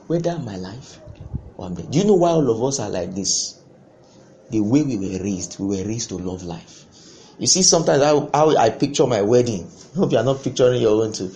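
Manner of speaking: ordinary talk, nothing marked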